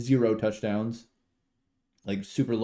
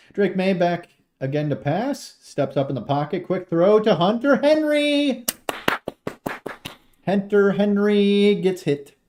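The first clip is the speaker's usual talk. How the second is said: building to a high, loud name call; live play-by-play; 8 semitones higher, 6 dB louder than usual. Real, not acted. Streamer calls throw to needed receiver, excited play-by-play. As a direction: surge into an excited shout on the catch.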